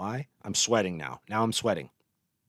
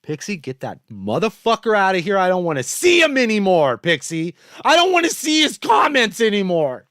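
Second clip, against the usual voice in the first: loud, higher, real fury, hammering each order; angry demands; 8 semitones higher, 6 dB louder than usual. Real, not acted. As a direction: bark at the moderator to ban him. Demanding the ban again.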